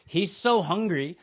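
ordinary talk, nothing marked

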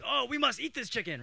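a hint of an excited father; lightly voiced dad. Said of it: "so he thinks".

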